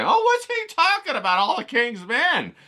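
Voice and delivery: curious viewer. Retold or imitated. Imitating a viewer puzzling over his catchy title, high and loud. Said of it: "I try to get you interested and say".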